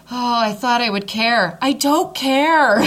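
high, building to a loud drawn-out cry; giddy exclaiming. Retold or imitated. Wife retells realizing she doesn't care, giddy exclaiming. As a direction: let it rise into a carefree holler. Retold realization during labor.

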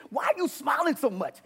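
high-pitched, irritated challenge; acting out a harsh confrontation; rough and hard. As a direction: demand it roughly, fed up. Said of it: "I would talk like this to him".

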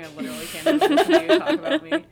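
loud, high, fast, breaking into laughter; laughing retelling. Retold or imitated. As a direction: quote your sister while cracking up. Cracking up while quoting embarrassed sister.

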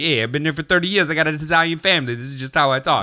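put-on fast caricatured Italian New York accent; Italian New Yorker. Buyer explaining his Italian family, fast Italian New Yorker accent. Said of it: "that's how you talk?".

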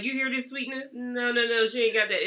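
ordinary delivery

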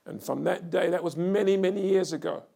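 loud, soaring preacher's cry; fiery revival preaching; preaching at full force. Acted. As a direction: preach it to the back row, building hard.

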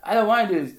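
frustrated whine. Retold or imitated. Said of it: "all frustrated".

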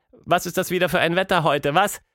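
ordinary delivery

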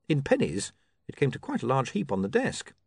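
plain reading voice

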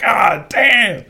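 loud, high re-enacted cry; shocked outcry. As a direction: cry it out in stunned disbelief. Re-enacting own shock at the story.

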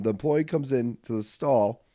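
ordinary delivery

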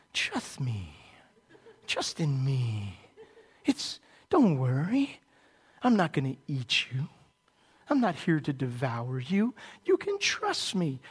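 a hint of a coaxing serpent lulling its prey; mild, hushed snake croon, partly whispered; sly snake voice. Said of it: "he does his little snake voice".

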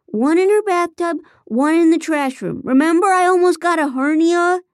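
high, loud defensive protest; insistent. Acted. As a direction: push back, proud and offended. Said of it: "Nate insisted".

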